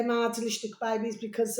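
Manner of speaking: ordinary delivery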